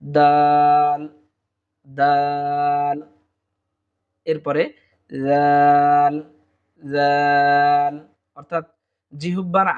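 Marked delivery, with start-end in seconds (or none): 0.0-3.0 দাল held long, tongue tip at the upper teeth roots; drawn-out Arabic dal. Shown as a demonstration. Teacher modelling দাল for students, drawn out. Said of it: "আমরা দাল হরফটি পেয়ে যাব". 5.1-7.9 জাল held long with the tongue tip at the teeth; drawn-out Arabic dhal. Shown as a demonstration. Teacher modelling জাল for the class, drawn out. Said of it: "লাগিয়ে দিলেই আমরা জাল".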